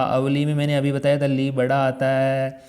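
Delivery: ordinary delivery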